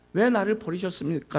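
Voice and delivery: ordinary delivery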